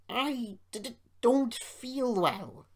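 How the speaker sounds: high, slow stammering quaver; nervous, faltering plea. A narrator's reading. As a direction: stammer it out, drawn out and wobbly. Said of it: "quavered Sir Handel".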